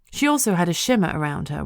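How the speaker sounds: plain reading voice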